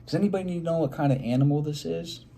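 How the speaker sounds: usual speaking voice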